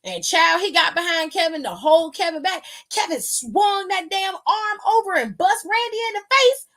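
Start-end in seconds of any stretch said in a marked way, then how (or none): 2.9-6.7 excited shout. Real, not acted. Hyped wrestling recap climax.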